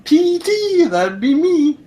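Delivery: very high repeated doll voice; a talking doll on each string pull; pull string toy. Pretend. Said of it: "the next time you pull".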